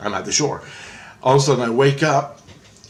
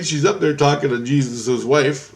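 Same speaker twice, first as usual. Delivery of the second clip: ordinary delivery, nothing marked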